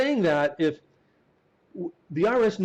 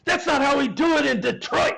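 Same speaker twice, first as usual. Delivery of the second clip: much higher, louder mock yell; retold yelling manager; 10 semitones higher, 4 dB louder than usual. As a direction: bark it like an indignant manager. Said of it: "he yells".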